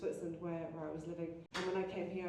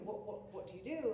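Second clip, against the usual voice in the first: normal talking voice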